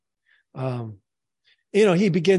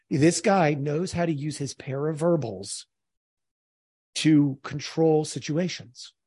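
ordinary talk

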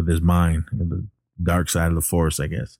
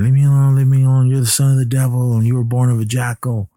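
high, loud frantic pleading; panicked pleading; 6 semitones higher, 5 dB louder, faster than usual. Retold or imitated. Mark begging Damien to stay away, higher and louder in panic. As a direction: back away begging him to leave you alone.

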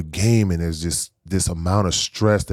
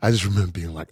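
ordinary delivery

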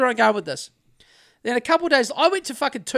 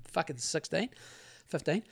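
usual speaking voice

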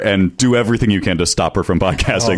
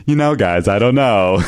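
sassy Trump; 2 semitones higher than usual. Retold or imitated. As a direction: imitate the dubbed Trump with a lilting camp delivery.

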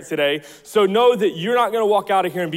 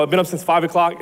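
ordinary delivery

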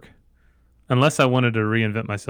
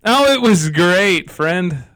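drawn-out cheer; 8 semitones higher, 8 dB louder, much slower than usual. Real, not acted. Exaggerated cheerful reply.

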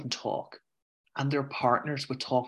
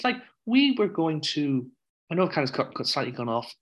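usual speaking voice

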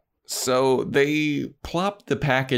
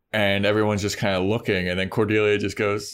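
ordinary talk

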